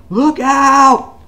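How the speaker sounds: high, loud mock yell; shouted warning. Alan warns the women of a zombie, high mock yell. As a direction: yell it like a sudden alarm.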